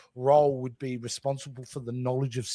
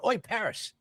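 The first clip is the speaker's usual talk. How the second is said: lightly voiced Australian jeer; Aussie heckle. An Australian crewman on Voyager taunting Paris, jeering. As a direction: needle him like a mocking Aussie mate. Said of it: "he's going up to go".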